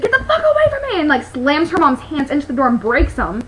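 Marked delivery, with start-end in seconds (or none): 0.0-1.0 mock furious yell. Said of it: "And she's like".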